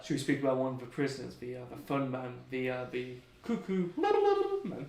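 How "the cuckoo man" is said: drawn-out cuckoo babble; mock cuckoo noise. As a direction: stretch it into a silly loony warble. Jokey crazy-person noise naming prisoner.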